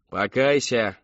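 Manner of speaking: loud, forceful cry; shouted command. A narrator's reading. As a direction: shout it at the sinner. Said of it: "выкрикнула миссис Рэдклифф".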